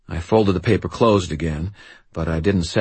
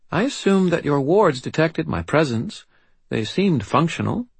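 put-on high, formal little-girl voice; composed, assured child with British accent; steady, assured child; 11 semitones higher than usual. A narrator's reading. Child tells wizard his wards sensed her, steady and assured. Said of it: "her voice steady and assured".

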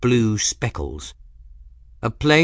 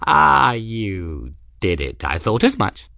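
put-on knowing old man; 3 dB louder than usual. Ferris pleased, knowing.

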